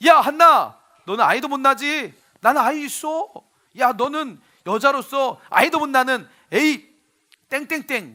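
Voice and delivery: loud, high, jeering taunt at a rival; mocking taunt. Second wife taunting childless Hannah, loud jeering. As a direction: sneer it out, lording it over her. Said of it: "욕까지 하면서 이렇게 한나를 무시하기".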